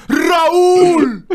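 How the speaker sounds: high, loud acted cry; hammed-up cry.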